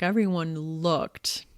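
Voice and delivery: ordinary talk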